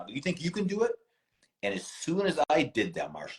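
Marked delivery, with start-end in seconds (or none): 0.1-0.9 high, mocking, provoking; taunting dare. Daring Mike to jump in, high and mocking. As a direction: poke the bear with a high sing-song dare. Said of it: "I went like this to him".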